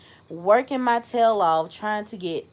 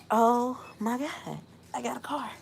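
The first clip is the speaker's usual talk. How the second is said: hushed disbelief; 2 semitones higher, 6 dB quieter than usual. Retold or imitated. Marvelling at her first car by herself, hushed. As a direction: say it small, as if stunned. Acts out stunned joy, voice hushed.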